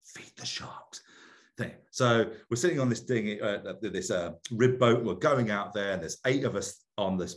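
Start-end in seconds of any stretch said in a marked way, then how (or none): 0.1-1.0 "Feed the sharks" breathed in a whisper; incredulous whisper. Retold or imitated. Echoing the divers' offer to feed sharks, stunned whisper. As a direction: mouth it back in quiet disbelief.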